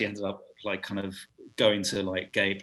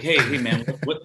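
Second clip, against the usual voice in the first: higher, louder put-on American accent; 6 semitones higher, 9 dB louder than usual. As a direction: imitate an American hailing someone. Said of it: "I'm only gonna do this a couple of times".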